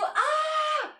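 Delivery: a sudden high, loud "ah!"; startled high cry on spotting someone; surprised shout. Real, not acted. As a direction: yelp out in recognition. Sudden recognition of a viewer.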